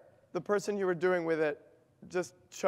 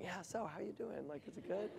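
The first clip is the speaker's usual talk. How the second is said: much softer, easy friendly chatter; mock a relaxed chat with a friend at lunch; casual lunch chat; 2 semitones higher, 14 dB quieter than usual. Teacher mocks friends chatting at lunch, soft and casual. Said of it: "sit there like this with our friends at lunch going".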